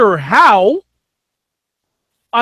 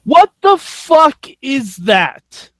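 slow, loaded incredulous question, pitched up; indignant, drawn-out bafflement, higher in pitch; emphatic disbelief; 5 semitones higher, much slower than usual. Real, not acted. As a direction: stretch each word in disbelief. Mock outrage at the co-managers news.

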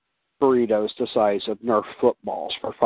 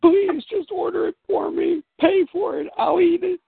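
very high, exaggerated begging; pleading. Grown child talking mom into paying, pleading. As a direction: bargain desperately in a put-on high voice.

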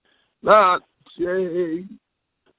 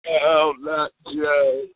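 mock grief; 7 semitones lower, slower than usual. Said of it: "Excuse me, I'm so emotional".

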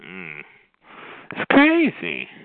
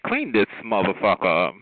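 lower, disgusted cat voice; voicing the cats; 7 semitones lower than usual. Cats glaring at owner, lower disgusted voice. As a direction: sneer it like a fed-up cat. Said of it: "the cats are looking at you like".

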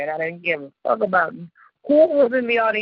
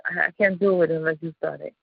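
ordinary talk

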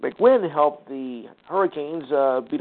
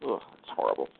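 disgusted groan; 13 semitones lower, 9 dB quieter than usual. Real, not acted. Disgusted reaction to blown lead.

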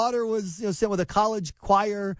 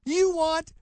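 mock outraged shout; 10 semitones higher than usual. Host acting a hysterical parent over popsicles, hammed-up shouting. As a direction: scream it like a hysterical activist parent.

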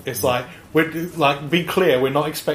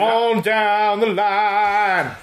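mock cowboy; 5 semitones higher, much slower than usual.

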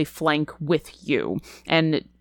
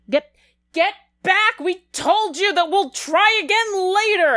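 high, strained shout of a desperate guard; yelling human guard; 11 semitones higher than usual. Acted. Guard yelling at charging kobolds, high and desperate. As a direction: yell them back as a frightened defender. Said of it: "You hear one of the humans yelling out".

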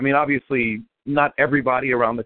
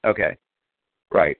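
usual speaking voice

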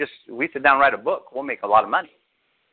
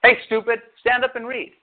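calling someone stupid, mildly raised; a playful fake put-down, pitched up; mock insult; 7 semitones higher than usual. Shown as a demonstration. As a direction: pastor mock-insulting a man to show offense. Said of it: "I'm gonna use this".

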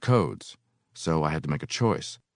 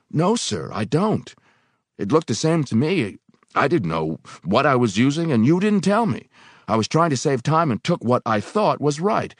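a lightly raised, pleading voice; pleading worker; 9 semitones higher, 4 dB louder than usual. Acted. Worker defending himself to the angry foreman. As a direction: plead your case earnestly.

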